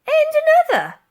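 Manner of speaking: put-on high, loud scandalised old soldier; old soldier shocked by a lady; shocked remark. A narrator's reading. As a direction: gasp at the very idea. Another old soldier adds.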